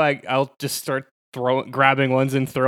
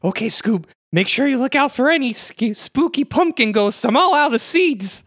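high-pitched cartoon stoner-sidekick voice; Shaggy; 9 semitones higher, 3 dB louder than usual. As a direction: warn your dog buddy in a high cartoon voice. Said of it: "Shaggy and Scooby".

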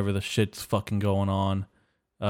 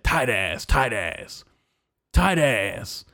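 imitating a bum; slower than usual. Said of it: "He said".

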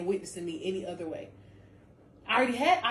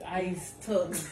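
ordinary talk, nothing marked

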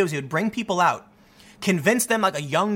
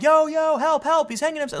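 mock panicked call, higher pitched; urgent call for help; 10 semitones higher than usual. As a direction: call for help as if you just found him. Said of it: "They might be like".

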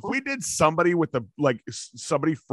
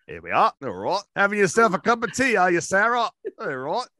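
lilting British catchphrases, jumping high mid-way; mock British accent; 5 semitones higher than usual. Co-host teasing the other over tea, mock British accent. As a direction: tease in a cartoon British accent. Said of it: "a British accent the entire show".

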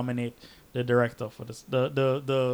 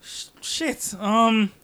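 panicking; 6 semitones higher than usual. Retold or imitated. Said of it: "He's like".

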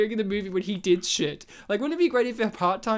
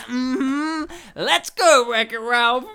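higher, loud, sassy mock order; imitated sassy teacher, pitched up; sassy older woman; 4 semitones higher, 5 dB louder, much slower than usual.

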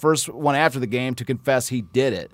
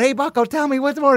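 put-on kids calling out; 11 semitones higher than usual. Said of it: "a bunch of kids going".

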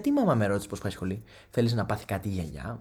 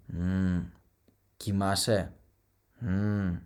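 fake sleepy hum; 5 semitones lower, much slower than usual. Faking sleepiness on phone.

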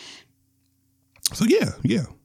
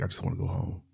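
much softer, tired pleading; worn-out quiet; 11 dB quieter, faster than usual. Retold or imitated. He tells his wife in the waiting room, very quiet. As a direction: barely voice it, wanting to leave. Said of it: "and I'm like".

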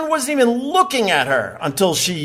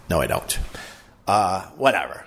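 ordinary talk, nothing marked

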